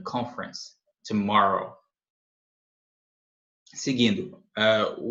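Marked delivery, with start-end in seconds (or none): 0.0-0.7 "conference" softly said as CON-frens; reduced "conference". Shown as a demonstration. Teacher repeating the reduced "conference" for learners, softly, as two syllables. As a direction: swallow the middle E of "conference", end on S. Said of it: "olha como fica reduzida".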